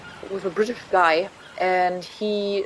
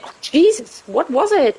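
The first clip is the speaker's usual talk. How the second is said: exasperated; 7 semitones higher, 5 dB louder than usual. Real, not acted. Straining to recall the name.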